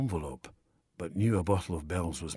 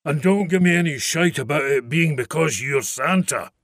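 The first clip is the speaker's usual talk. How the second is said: loud, demanding; a red-faced man storming in; angry father; 6 semitones higher, 7 dB louder than usual. A narrator's reading.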